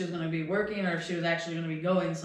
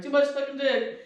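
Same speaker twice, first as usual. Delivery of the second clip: higher-pitched put-on jeer; mocking aside; 7 semitones higher, 3 dB louder than usual. Driver mocks passenger's sore throat excuse, high put-on jeer. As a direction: retell a silent jab in a mock voice. Retelling private jab at passenger.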